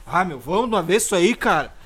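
hammed-up irritation; mock annoyed outburst. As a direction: act out an impatient friend losing his temper. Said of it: "o cara já fica brabo".

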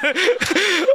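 squealing high laugh; high giggle. Retold or imitated. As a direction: burst into a shrieking giggle. Reacting to the couple's fight.

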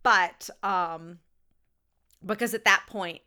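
normal talking voice

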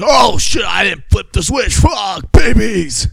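loud, high mock-panic yell; panicked shout. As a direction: yell it like a horrified realization, last words drawn out. Acts out panicked man who forgot switch.

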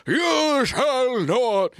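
high, drawn-out wizard command; Ian McKellen. Acted. Podcast host quoting Gandalf's famous command, slow grand impression. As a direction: stretch every word of the movie quote grandly. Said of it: "Oh, Ian McKellen".

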